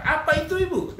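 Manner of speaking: high, eager mock question; curious. Curious pastor asking a wife what it is, curious. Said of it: "masih penasaran dan berkata".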